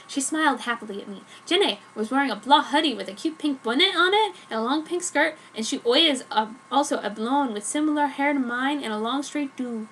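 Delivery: plain reading voice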